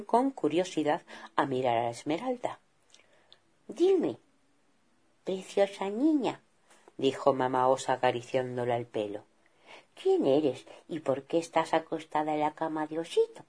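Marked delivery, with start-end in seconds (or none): 3.7-13.4 soft, gentle coaxing; mother bear stroking the girl's hair; hushed kind question; tender mother. Acted. Mother caresses the girl.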